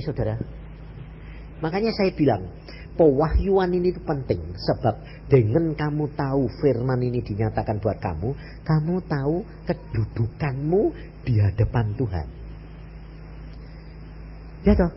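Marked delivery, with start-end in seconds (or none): none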